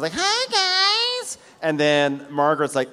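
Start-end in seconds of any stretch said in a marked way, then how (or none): 0.2-1.4 very high, gushing hello, imitated; sugary high-pitched group hello; all-smiles greeting. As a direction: mimic a squealed, smiley greeting. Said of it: "they're all smiles".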